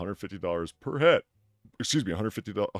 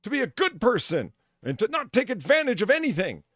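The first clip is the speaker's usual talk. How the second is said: high mocking voice, rising higher; mock high-pitched quote; 13 semitones higher, 6 dB louder than usual. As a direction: read it like a prissy influencer.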